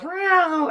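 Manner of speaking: squeaky cat meow; the cat demanding food with a high meow; high mock meow. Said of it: "hits him on the leg and goes".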